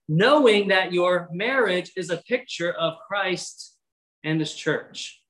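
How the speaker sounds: ordinary talk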